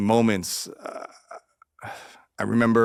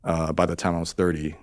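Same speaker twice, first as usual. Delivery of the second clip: usual speaking voice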